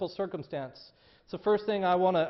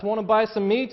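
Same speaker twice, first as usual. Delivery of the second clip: joking; 4 semitones higher, 8 dB louder than usual. Acted. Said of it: "jokingly asks".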